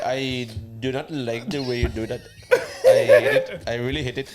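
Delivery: laughing through a mock Indian accent; mock Indian accent, breaking into laughter. Friends joking, doing a mock Indian accent while laughing. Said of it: "It's an accent".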